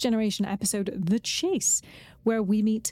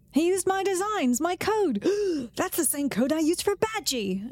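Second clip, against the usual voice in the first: higher, rising panicked quote; acted quote; 7 semitones higher than usual. Co-host acts out Rutherford noticing his code was reused. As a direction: voice Rutherford's alarm at the stolen code. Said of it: "he said".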